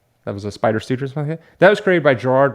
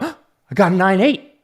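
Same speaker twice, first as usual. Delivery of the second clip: higher and slow, thrilled by the grade; excited; 8 semitones higher, 4 dB louder, slower than usual. Retold or imitated. Collector announcing a top grade to girlfriend, excited. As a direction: savor the news like a prize. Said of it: "I'm like".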